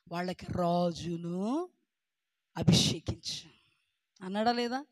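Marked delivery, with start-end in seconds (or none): 0.1-3.5 God's voice. Acted. God speaking to Samuel.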